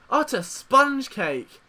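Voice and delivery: ordinary delivery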